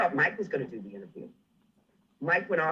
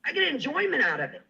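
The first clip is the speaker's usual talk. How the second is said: gleeful gloat; 12 semitones higher, 4 dB louder than usual. Real, not acted. Gloating after the outburst.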